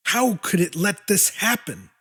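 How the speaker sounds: usual speaking voice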